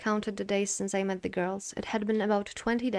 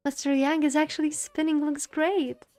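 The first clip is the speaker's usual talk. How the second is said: put-on excited child; 7 semitones higher, 4 dB louder than usual. Delighted child with wind wheel.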